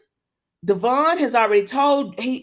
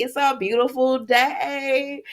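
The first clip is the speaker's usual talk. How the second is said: slow sarcastic drawl of her line; mocking, slowed-down sing-song; mocking repeat; 3 semitones higher, slower than usual. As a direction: mimic her greeting slowly and snidely. [name] mocking Phyllis's words.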